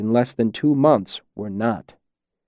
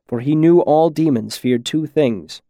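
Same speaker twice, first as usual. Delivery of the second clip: usual speaking voice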